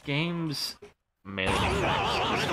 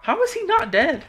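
surprised; 6 dB louder than usual. Real, not acted. Enemy survives his attack.

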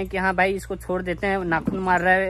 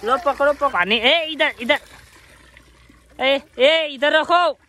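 calling out; 5 semitones higher, slower than usual. Real, not acted. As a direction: call them over across the yard. Calling and directing monkeys. (बंदरों को ज़ोर से बुलाना)

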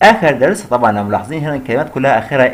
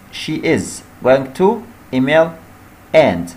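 slow dictation; much slower than usual. Shown as a demonstration. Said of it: "نبدأ بالكلمة اللي أولها capital letter".

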